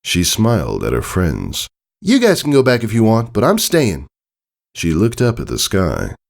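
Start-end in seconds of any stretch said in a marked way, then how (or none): 2.0-4.1 put-on much higher young filly voice; Scootaloo's voice. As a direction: raise the voice high like a young pony.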